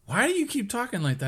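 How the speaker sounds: high-pitched retold challenge; puzzled questioning. Acts out them questioning his accent.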